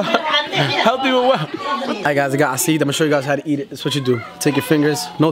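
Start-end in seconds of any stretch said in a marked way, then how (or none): 0.0-1.4 slow laughing disbelief. Real, not acted. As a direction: drag it out, laughing. Mocking reply while laughing.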